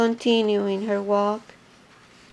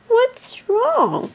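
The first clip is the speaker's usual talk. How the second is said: cried out, upset; 11 semitones higher than usual. Acted. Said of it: "cried the hippopotamus".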